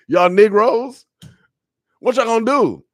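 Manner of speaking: loud, high-pitched playful taunt; mock challenge. Preacher jokingly challenging his listeners, loud taunt. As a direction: call them out at full voice, half joking.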